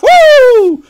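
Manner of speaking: sudden high whoop of joy; excited whoop. Retold or imitated. Whooping at Starbucks coffee, hyped. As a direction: let out a hyped woo.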